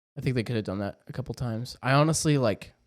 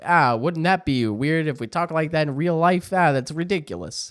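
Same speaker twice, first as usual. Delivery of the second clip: a bit higher and louder, Mario-style Italian lilt; mock Italian accent; 6 semitones higher, 4 dB louder than usual. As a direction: brothers joking in their cartoon accent. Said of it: "they talk like that in the commercial".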